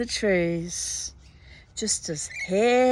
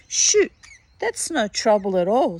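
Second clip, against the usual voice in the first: boastful hairy man; 2 semitones higher, 5 dB louder than usual. Acted. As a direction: brush off the dare with a yelp.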